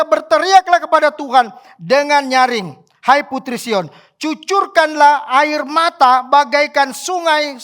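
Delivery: loud, slow, drawn-out proclamation; impassioned reading with stretched key words; declaimed scripture. A narrator's reading. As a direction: preach the verse at full force. Preacher declaims verse at full force.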